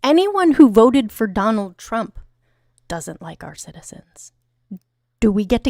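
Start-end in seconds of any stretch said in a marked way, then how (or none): 0.0-2.2 loud retort. Real, not acted. Angry reply to chat. 2.9-4.3 pointed whisper. Real, not acted.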